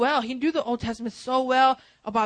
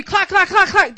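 loud, high clacking like a cymbal; clanging cymbal noise; 8 semitones higher, 11 dB louder than usual. Shown as a demonstration. Pastor mimics the cymbal God hears instead of worship, loud clacking. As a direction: bang out the clacks like a hollow cymbal. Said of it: "That's all God's hearing".

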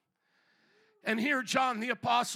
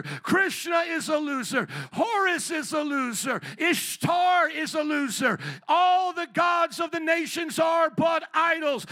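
loud, driving pulpit proclamation; full-force preaching; 3 semitones higher, 6 dB louder than usual. Real, not acted. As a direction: hammer each declaration home to the congregation.

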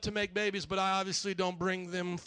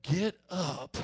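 inner voice; 4 semitones lower than usual. Retold or imitated. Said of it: "I just heard this voice inside of me".

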